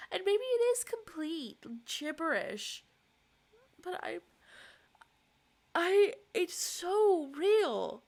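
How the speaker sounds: high, loud sobbing words; crying. Real, not acted. Vlogger breaking down on camera, sobbing high and loud. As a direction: let the sob break through. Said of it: "This is a very interesting cry".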